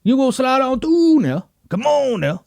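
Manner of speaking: put-on high, smooth crooning old man spitting game; smooth old player. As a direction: sweet-talk a girl like an old charmer. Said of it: "The old guys who are like".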